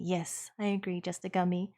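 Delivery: normal talking voice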